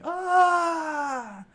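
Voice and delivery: very high, loud scream of pain; dying scream. A narrator's reading. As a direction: scream as the bullets hit. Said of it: "leaving them screaming".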